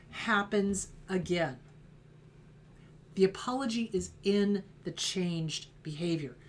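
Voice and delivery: ordinary delivery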